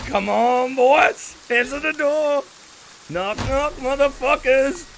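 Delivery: hammed-up killer bravado; taunting holler. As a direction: call through the door to scare them. Player taunting victims behind door.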